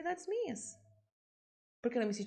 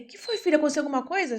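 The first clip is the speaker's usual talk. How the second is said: alarmed father; 4 semitones higher, 9 dB louder, faster than usual. Retold or imitated. Father voiced, alarmed call.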